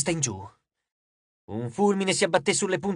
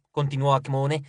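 ordinary delivery

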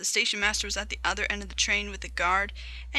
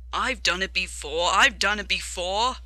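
lightly voiced chugging engine; puffing engine; 4 dB louder than usual. Edward climbing the hill beside James, lightly puffed. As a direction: repeat it in a puffing rhythm. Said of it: "puffed Edward".

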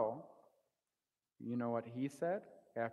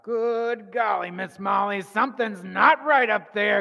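big storyteller's cry of alarm; alarmed exclamation; 6 semitones higher, 14 dB louder than usual. Retold or imitated. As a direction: blurt it out loud and high, retelling your alarm. Retelling his alarm at his boys.

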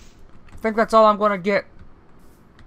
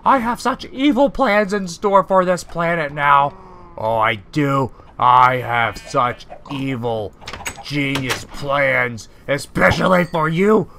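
dark lord; 8 semitones lower, slower than usual. Acted. Evil plans speech, deep slow.

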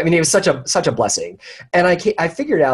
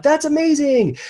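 gushing over a friend's Paris news; retold amazement; 12 semitones higher, slower than usual. Retelling his delighted reaction, pitch jumping way up. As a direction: voice leaps high on amazing.

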